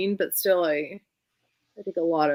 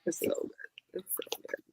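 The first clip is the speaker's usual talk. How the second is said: whispered, softer repeat; 12 dB quieter than usual. Real, not acted. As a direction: hush it like a secret. Repeats praise in a hush.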